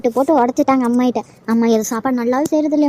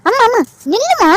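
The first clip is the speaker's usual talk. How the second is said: calling out; 9 semitones higher than usual. Acted. Daughter calls after leaving mother.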